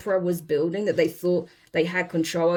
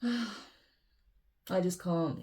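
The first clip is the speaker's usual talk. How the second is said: sighing, giving up; 8 dB quieter, much slower than usual. Real, not acted. Overwhelmed, gives up.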